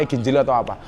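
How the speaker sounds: ordinary delivery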